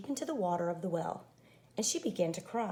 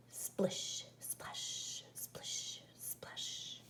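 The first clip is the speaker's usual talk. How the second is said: fading whisper, each word softer; whispered splish splash; 12 dB quieter, much slower than usual. A narrator's reading. Narrator voicing wet feet approaching, fading whisper. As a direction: breathe the sounds like distant wet footsteps. Strange noises outside.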